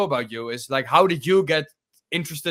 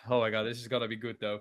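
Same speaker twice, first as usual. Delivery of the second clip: ordinary talk, nothing marked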